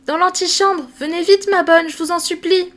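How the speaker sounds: put-on little girl begging her nurse to come; pleading child. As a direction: beg her to hurry, childlike and high. Sophie begs nurse to hurry.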